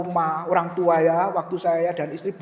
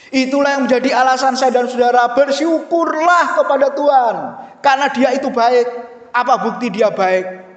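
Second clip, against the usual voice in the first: loud, high preaching call to give thanks; full-force preaching; 6 semitones higher, 8 dB louder than usual. Acted. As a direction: proclaim it with full force to the congregation.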